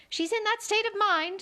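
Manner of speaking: high, fluttery queenly voice; a high, dithering old queen. A narrator's reading.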